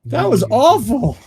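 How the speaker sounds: high, drawn-out disgust. Acted. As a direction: groan it out, high and slow. Recalling a bad trip.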